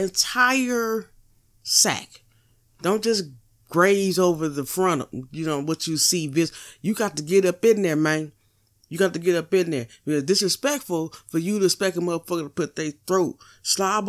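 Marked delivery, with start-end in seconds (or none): none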